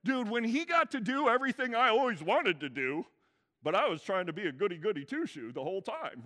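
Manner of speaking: a lightly voiced, bratty rant; a hint of a sulking teen; older son venting. Preacher imitating the jealous older son, lightly whiny. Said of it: "the older son is like".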